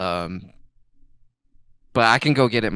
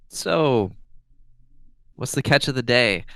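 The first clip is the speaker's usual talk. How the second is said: raised, chummy opener; buddy-buddy; 3 semitones higher than usual. Acted. Galen chatting up the innkeeper, buddy-buddy. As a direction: chat him up like an old pal. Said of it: "tries to be buddy-buddy with him".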